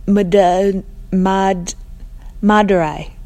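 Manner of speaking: loud, slow, stumbling on Mad-. Acted. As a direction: fumble the name while calling out. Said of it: "in the same raised voice".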